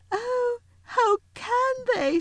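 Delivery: tearful Lucy. A narrator's reading. Lucy weeping at the cruelty. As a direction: plead through tears. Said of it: "tears streaming down her cheeks".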